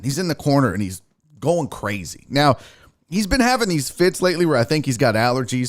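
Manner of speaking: usual speaking voice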